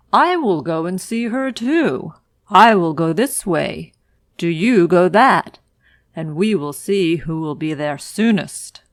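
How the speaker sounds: loud, boastful wolf challenge. Acted. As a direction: call out the race like a cocky wolf. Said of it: "said the wolf".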